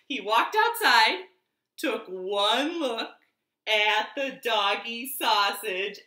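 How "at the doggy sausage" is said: plain reading voice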